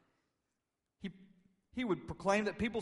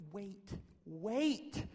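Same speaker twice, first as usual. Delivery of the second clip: dawning disbelief; 5 semitones higher than usual. Retold or imitated. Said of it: "slow disciples probably had to say".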